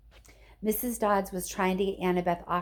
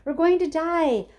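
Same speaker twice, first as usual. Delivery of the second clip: high, loud terrified scream; panicked yell; 11 semitones higher, 8 dB louder than usual. A narrator's reading.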